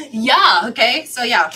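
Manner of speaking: loud, flustered recovery; loud reaction. Real, not acted. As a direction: shake it off out loud. Loud reaction after gasp.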